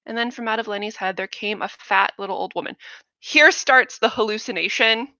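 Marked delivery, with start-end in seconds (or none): none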